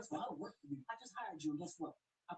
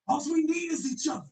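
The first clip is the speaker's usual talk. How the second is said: hype shout; 10 semitones higher, 19 dB louder than usual. Acted. Hype chant repeated.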